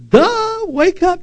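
high, drawn-out exasperated "duh"; mocking duh. Preacher to those fighting God, mocking.

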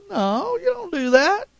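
usual speaking voice

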